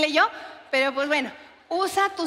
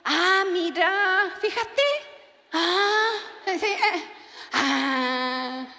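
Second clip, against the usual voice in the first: mock whiny husband; 3 semitones higher, much slower than usual. Husband's mocking reply.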